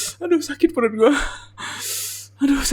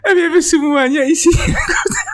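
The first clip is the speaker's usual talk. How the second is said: laughing-crying shout; 7 semitones higher than usual. Real, not acted. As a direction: squeeze the words out between sobs of laughter. Rereading funny comment while laughing. (teriak sambil tertawa terisak)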